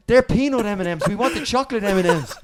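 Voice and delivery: loud, high-pitched fussy star; put-on indignant demand; mock demanding complaint. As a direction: act out an outraged sweets complaint. Acted diva complaint about the sweets.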